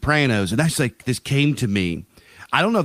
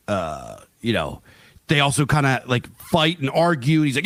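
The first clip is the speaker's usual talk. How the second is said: normal talking voice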